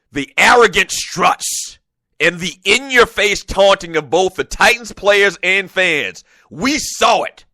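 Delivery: drill sergeant voice. Retold or imitated. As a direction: read it like a barking drill sergeant. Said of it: "I don't know why I'm talking like a drill sergeant".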